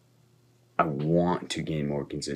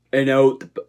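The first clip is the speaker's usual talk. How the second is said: loud, high, hammed-up bad Australian accent; horrible Australian accent; 8 semitones higher, 13 dB louder than usual. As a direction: belt it out in a mock Australian accent, badly. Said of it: "That is a horrible Australian accent".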